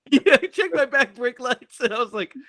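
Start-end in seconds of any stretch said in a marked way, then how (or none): none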